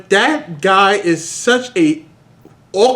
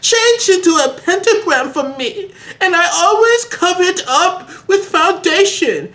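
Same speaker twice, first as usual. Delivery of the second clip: high whiny hero voice; 11 semitones higher than usual. Acted. Voicing Harry in fanfic reading.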